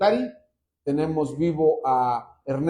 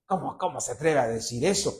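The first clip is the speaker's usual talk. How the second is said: doubting churchgoer; 6 dB quieter than usual. Pretend. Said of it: "Y ustedes van a decir".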